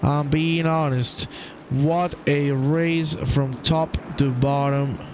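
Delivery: ordinary talk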